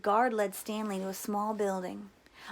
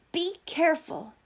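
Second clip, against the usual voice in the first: driver's warning; 7 semitones higher, 4 dB louder than usual. A narrator's reading. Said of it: "the bus driver said".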